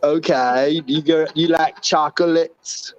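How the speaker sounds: Forrest Gump impression. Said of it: "Life is like a box of chocolates".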